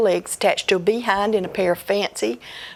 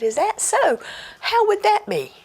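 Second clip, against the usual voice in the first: put-on higher, bright-eyed boy asking; boy asks the old man, curious and eager; eager young boy; 13 semitones higher than usual. Boy questions the old man, higher and eager.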